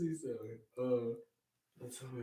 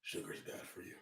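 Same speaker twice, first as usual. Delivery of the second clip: Batman voice; 7 semitones higher, 8 dB quieter, much faster than usual. Pretend. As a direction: growl it like Batman. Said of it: "in the voice of Batman".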